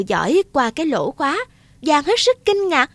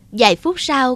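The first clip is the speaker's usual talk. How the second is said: ordinary delivery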